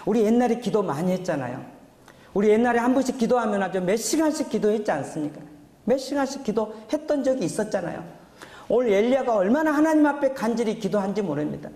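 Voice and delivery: high-pitched, urgent exhortation; impassioned preaching. Real, not acted. Pastor urging the congregation back to prayer, fervent preaching. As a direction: preach it with rising fervor, pitched well up.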